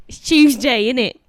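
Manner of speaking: very loud colloquial British; rougher British accent. Shown as a demonstration. Guest demonstrating British slang, loud rough accent. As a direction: put on a rough British voice, loudly. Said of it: "Little rougher".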